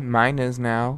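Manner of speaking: ordinary delivery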